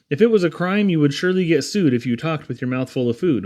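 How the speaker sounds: ordinary delivery, nothing marked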